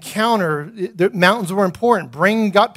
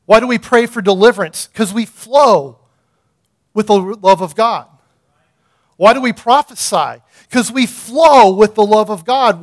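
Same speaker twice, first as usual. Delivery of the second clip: louder, rising call-and-response preaching; fiery preaching; 2 semitones higher, 6 dB louder than usual. Acted. Pastor preaching to the congregation with rising force. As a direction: drive each question home to the crowd.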